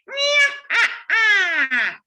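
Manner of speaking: high, loud nasal witch cackle; witch's cackle. Shown as a demonstration. Coach shows the class a witch laugh. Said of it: "a witch's laugh".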